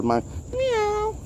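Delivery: high, soft imitated meow; cat meow imitation. Shown as a demonstration. Calling the stray cat with his own meow. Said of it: "what I do here, my meow".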